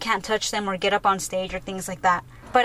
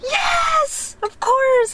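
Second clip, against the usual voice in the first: very high, squealed reply; real excited; 14 semitones higher, much slower than usual. Retold or imitated. Answering her friend who noticed her excitement, high excited squeal. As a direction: imitate an overexcited fan squealing agreement. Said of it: "you're, like, real excited".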